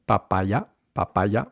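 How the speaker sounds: equal beats on Papaya, rising at the end; evenly timed, unaspirated Papaya; even Spanish syllables. Shown as a demonstration. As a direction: Papaya with no puff on the p. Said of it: "keeping each syllable the same length".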